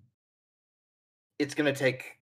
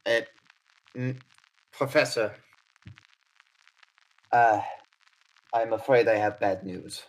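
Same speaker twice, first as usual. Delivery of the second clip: Odette, grave; 6 dB louder than usual. Acted. Odette telling the professor Charlie's been shot, grave and louder. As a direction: deliver bad news to the professor urgently.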